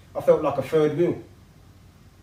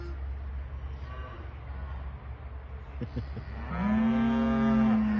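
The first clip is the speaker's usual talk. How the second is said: long laugh; 10 semitones higher, 5 dB quieter than usual. Real, not acted. Laughing at the cows.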